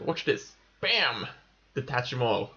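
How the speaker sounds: ordinary talk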